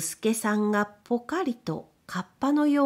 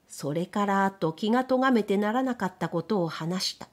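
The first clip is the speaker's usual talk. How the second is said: usual speaking voice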